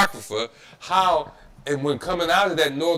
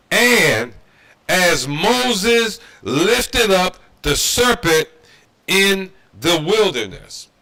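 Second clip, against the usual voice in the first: loud and very slow scripture read; preacher reading scripture slowly for the slow learners; slow, deliberate reading; 3 semitones higher, 6 dB louder, slower than usual. Shown as a demonstration. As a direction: draw out every word of the verse. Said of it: "I'm gonna read this slow".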